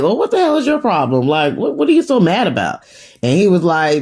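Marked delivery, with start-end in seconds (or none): 0.0-2.8 high-pitched, affronted disbelief; retold indignant shock, pitched up. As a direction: act out a shocked mother demanding answers. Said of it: "Rock is just looking shocked".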